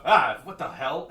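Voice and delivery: startled. Acted. Guard startled by sword drawn.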